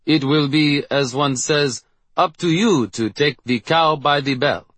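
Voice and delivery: lightly done French Canadian principal; French Canadian accent, a mangled cliché. Acted. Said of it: "Frenchly Canadian voice of our principal".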